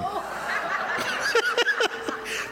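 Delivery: airy high-pitched laugh; high breathy laugh. Real, not acted. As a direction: burst out laughing at the joke. Laughs at own story.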